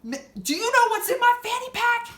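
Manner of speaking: hammed-up threatening yell; mock threat. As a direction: bark the threat like a tough guy. Man threatening about fanny pack.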